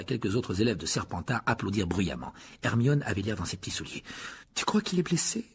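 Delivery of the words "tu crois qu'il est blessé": put-on high, anxious girl's question; worried voice. A narrator's reading. Hermione asks if the teacher is hurt, worried and high. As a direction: ask it with concern, pitched up. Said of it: "demanda-t-elle d'une voix inquiète".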